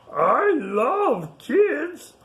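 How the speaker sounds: big friendly giant, drawn out and sung high; Mister Biggs voice. Acted. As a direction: stretch each word out high as the gentle giant. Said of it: "Mister Biggs says".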